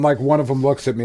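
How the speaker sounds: ordinary talk, nothing marked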